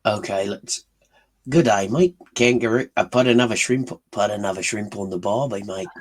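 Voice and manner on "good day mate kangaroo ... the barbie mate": Australian accent. Shown as a demonstration. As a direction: do a cartoon Aussie accent, punchy opening. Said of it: "can you do a Australian accent?".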